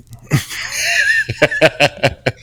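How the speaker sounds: long, high burst of laughter; high squealing laugh. Real, not acted. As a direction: let a high laugh ring out. Laughing at the tilapia diet.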